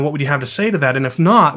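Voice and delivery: normal talking voice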